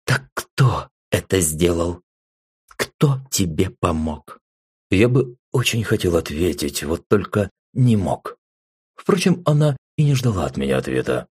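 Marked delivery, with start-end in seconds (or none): none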